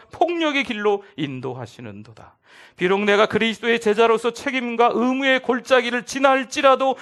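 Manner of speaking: solemn parody recitation building loud; mock-reverent reading of a parody psalm. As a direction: intone the TV psalm like scripture, swelling. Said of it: "다른 버전을 한번 읽어보려고 합니다".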